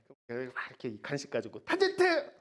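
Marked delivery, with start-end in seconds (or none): 1.6-2.3 calling the dog. Retold or imitated. Teacher calling her dog the wrong name. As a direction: call out to the puppy with a treat. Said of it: "이러면 걔가".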